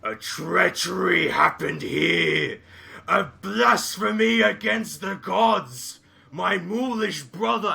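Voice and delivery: loud, slow outraged declaration; grim proclamation. Acted. Ogre announces the slaughter to his kinsman, slow and booming. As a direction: slowly boom out the outrage.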